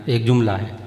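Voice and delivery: normal talking voice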